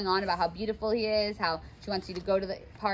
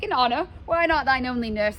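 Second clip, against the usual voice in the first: higher, louder mock outrage; indignant nurse; 5 semitones higher, 6 dB louder than usual. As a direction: scoff at the word honor. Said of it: "the nurse is like".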